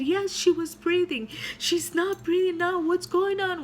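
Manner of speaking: high, urgent retelling of a panicked doctor; retold panicked doctor. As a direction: sound alarmed that she stopped breathing. Doctor panics, patient stopped breathing.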